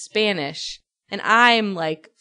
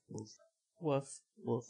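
much softer, limp "woof, woof" with no energy; bored woof; 8 semitones lower, 18 dB quieter than usual. Retold or imitated. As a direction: bark like a bored person saying it. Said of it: "a bored person saying it".

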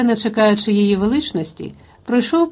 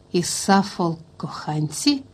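hushed, halting half-whisper of disbelief; barely voiced, incredulous question; stunned hush; 3 semitones lower, 6 dB quieter, slower than usual. A narrator's reading. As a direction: trail into a slow, near-whispered question. Son stunned by news of affair.

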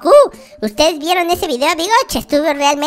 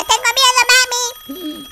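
slow, much higher whimpering call for mommy; hammed-up baby fear, calling for mom; scared baby; 10 semitones higher, slower than usual. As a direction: cry out like a lost little child. Baby alone waiting for mommy.

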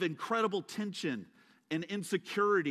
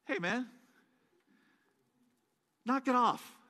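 soft, offhand brush-off; a laid-back angel shrugging; casual angel; 3 semitones higher, 4 dB quieter than usual. Retold or imitated. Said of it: "the angel comes to John and goes".

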